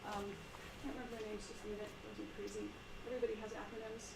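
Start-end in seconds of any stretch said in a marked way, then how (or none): none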